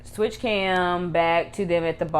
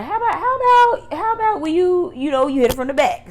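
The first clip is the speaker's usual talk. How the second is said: much higher, louder put-on flirty voice; coy suggestion; 12 semitones higher, 7 dB louder than usual. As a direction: ask it sweetly, pitched way up. Voicing Ella proposing a switch.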